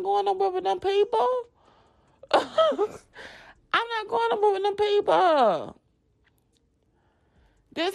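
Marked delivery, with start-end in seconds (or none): 2.3-5.8 laughing. Retold or imitated. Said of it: "repeat slower laughing".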